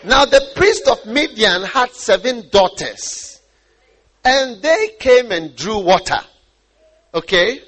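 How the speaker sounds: loud, slow, emphatic Bible reading; scripture reading. Acted. Preacher reading of Midian's priest and daughters, loud proclamation. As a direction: proclaim the verse slowly and forcefully.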